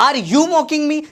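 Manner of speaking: "Are you mocking me" pitched high, drawn on YOU; acted challenge. Teacher acts out an offended question. (ऊँचे सुर में सवालिया चुनौती)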